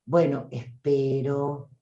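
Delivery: slow, low, drawn-out delivery; plodding mock-solemn drone; slow, halting speaker. Shown as a demonstration. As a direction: leave long pauses and let the energy sag. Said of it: "como te dice la pausada".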